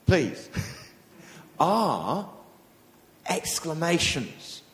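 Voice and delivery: ordinary talk, nothing marked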